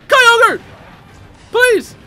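high, loud squeals at each item; excited yelling. Real, not acted. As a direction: yell each name like a hyped gamer. Items appear mid-game.

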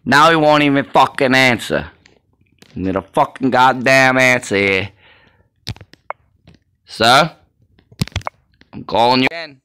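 loud, cursing threats down the phone; angry yelling. Acted. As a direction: demand the number and yell it. No answer, shouting into phone.

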